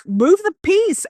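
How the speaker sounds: high, urgent retold cry; panicked plea. Friend panicking at the ouija board, high frantic cry. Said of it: "my friend was kinda panicking".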